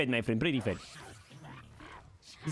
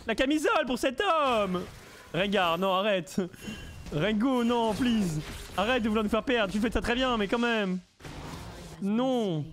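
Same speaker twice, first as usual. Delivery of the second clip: frantic pleading; 5 semitones higher than usual. Real, not acted. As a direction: beg the teammate to stop, all worked up. Pleading with teammate Rengar. (supplication affolée, aiguë et forte)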